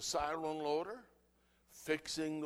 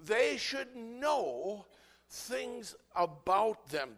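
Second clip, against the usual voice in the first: slow, stretched, raised repeat; drawn-out emphasis; 6 semitones higher, 8 dB louder, much slower than usual. Real, not acted. Preacher repeating the point slowly to the church. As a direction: draw every word out.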